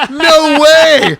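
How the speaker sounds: loud, high "no way" of disbelief; high-pitched shocked gasp of words; mock disbelief. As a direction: blurt it out, amazed. Surprised reaction to retold prank.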